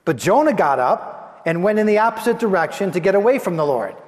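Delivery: ordinary delivery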